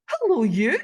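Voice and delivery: loud, high, gushing hello; effusive greeting. Retold or imitated. Greeting a stranger on the bus, effusively. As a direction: greet them like an old friend, loud and high. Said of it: "it was so effusive".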